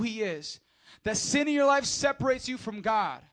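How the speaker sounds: loud, high fiery preaching; full-throttle preacher; preaching at full force. Acted. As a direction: thunder it from the pulpit.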